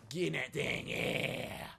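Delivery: rough, strained cheer into a yell; gung-ho yell. Pretend. Gamer hyping himself into the pod, gung-ho yell. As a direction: yell it as you climb in.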